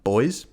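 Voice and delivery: put-on boys said as one syllable, like the plural of boy. Shown as a demonstration. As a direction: make buoys rhyme with toys: boys. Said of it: "like the word".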